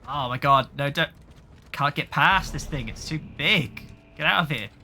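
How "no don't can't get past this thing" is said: usual speaking voice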